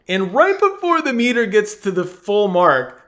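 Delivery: ordinary talk, nothing marked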